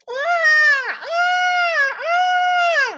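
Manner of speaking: very high, loud mock baby crying; imitated baby wail. Preacher imitates a hungry baby crying, high wailing. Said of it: "ada bayi yang sedang menangis".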